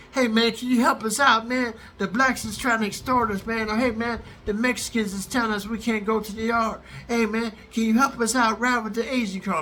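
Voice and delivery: pleading inmates. Retold or imitated. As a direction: imitate needy guys begging for backup.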